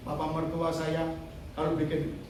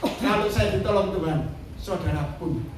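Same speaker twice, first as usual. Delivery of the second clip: loud, rallying call to the congregation; rousing preaching; 5 dB louder than usual. Real, not acted. Preacher promising the congregation God's help, rousing exhortation. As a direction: urge the congregation to believe.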